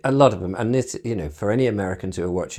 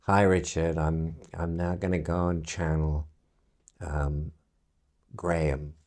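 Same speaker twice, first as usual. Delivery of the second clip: soft, low imitation of a weak-voiced woman; understated, quiet medium voice; 5 semitones lower, 5 dB quieter than usual. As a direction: lightly mimic a quiet, monotone channeler. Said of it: "she had a sort of fairly weak voice".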